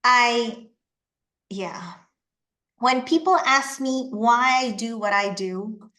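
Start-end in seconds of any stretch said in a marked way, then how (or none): 0.1-1.9 choked up. Real, not acted. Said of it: "I'm getting emotional".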